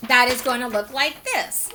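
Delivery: usual speaking voice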